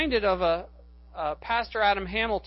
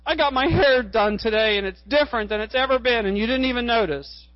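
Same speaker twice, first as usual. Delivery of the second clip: mock-upset wife, louder and higher; raised-voice mock tearful reproach; retold tearful complaint; 3 semitones higher, 6 dB louder, faster than usual. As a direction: imitate a hurt wife's outburst without real sobbing. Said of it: "she starts crying, and she says".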